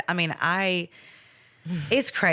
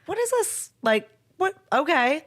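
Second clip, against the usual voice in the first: high-pitched puzzled reaction; retold bewilderment, high voice; baffled reaction; 10 semitones higher than usual. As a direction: act out being thrown by it, pitched way up. Retells first reaction to the beans.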